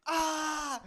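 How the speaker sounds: high pained yelp, retold; retold scream. Telling a friend about the sprain, high yelp. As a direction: yelp high like the moment it hurt. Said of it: "I screamed, dude".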